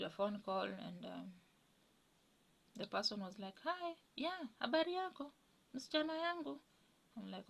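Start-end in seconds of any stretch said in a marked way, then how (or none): 3.6-6.6 high, sweet-talking caller voice; higher voice of a mother faking friendliness in Swahili; mother's put-on voice. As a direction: play the mother-in-law putting on a sugary phone voice. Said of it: "talking to me with a different tone of voice".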